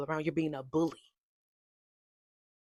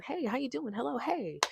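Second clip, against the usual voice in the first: super social greeter; 8 semitones higher, 7 dB quieter than usual. Retold or imitated. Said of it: "super social person".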